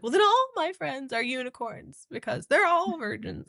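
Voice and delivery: high, flustered comeback; imitated flustered protest; spastic retort. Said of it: "kind of spastic".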